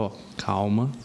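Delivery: slow the patient down gently; demonstrating a calm, measured instruction. Shown as a demonstration. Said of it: "conversa um pouco mais devagar".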